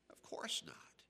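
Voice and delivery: of course not, breathed quietly; whispered. Acted. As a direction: drop to a whisper. Hushed aside after emphatic quote.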